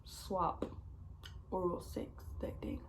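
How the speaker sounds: hushed, voiceless whisper. Real, not acted. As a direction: drop to a secret whisper. Lowers voice for a taboo detail.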